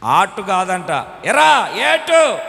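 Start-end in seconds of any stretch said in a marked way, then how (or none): 1.2-2.4 higher, very slow drawn question; drawn-out father question. Retold or imitated. Father asking his son which dosa, drawn out. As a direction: stretch the question out.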